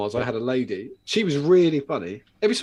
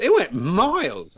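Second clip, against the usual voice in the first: excited; 7 semitones higher, 5 dB louder, slower than usual. Retold or imitated. Customer amazed at her throw.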